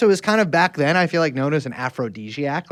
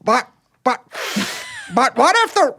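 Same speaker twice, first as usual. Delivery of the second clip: attempted Boston accent; 12 semitones higher than usual. Podcast host imitating JFK's Boston accent, breaking off. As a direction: try a Boston accent, then give up. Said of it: "I can't do it in Boston accent".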